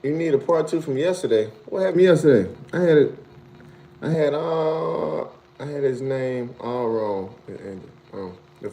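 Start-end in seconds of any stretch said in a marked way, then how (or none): none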